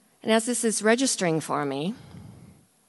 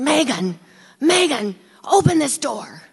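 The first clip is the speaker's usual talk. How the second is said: urgent shouted pleading through a door; loud, desperate calling, higher; frantic yelling; 6 semitones higher, 7 dB louder, slower than usual. Retold or imitated. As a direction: bang on the door and yell her name. Guy shaking the stall door.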